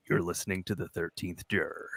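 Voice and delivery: low, soft radio-intro voice; imagined show intro. Retold or imitated. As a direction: drop low and quiet for an imitated show intro. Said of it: "have him be like".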